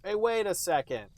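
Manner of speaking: usual speaking voice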